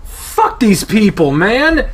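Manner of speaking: loud, slow frustrated outburst; exasperated. Acted. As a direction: spit out the frustration.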